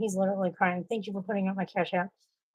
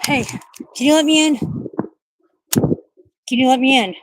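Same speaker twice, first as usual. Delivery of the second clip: loud, higher call through the door; 5 semitones higher, 10 dB louder than usual. Real, not acted. Locked outside, calling to family inside: loud call. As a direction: shout to be let in.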